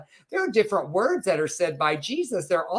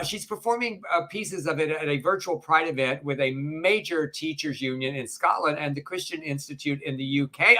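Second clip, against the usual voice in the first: ordinary delivery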